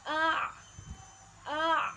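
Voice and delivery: A pushed from the throat, loud; loud demo A with ain. Shown as a demonstration. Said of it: "harus berbeda".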